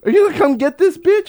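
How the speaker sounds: high, loud retold plea; exasperated call-out. Man holding the woman calls the cop, loud exasperated holler. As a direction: holler for the distracted officer to take over.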